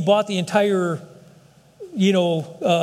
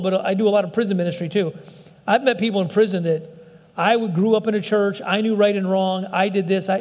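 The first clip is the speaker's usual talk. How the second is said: ordinary talk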